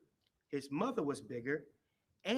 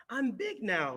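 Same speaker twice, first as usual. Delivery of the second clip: higher, louder happy call; excited cry; 6 semitones higher, 5 dB louder, slower than usual. A narrator's reading. Little hippo runs past the elephant, crying out proudly. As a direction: shout it out with glee. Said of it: "cried Little Hippo".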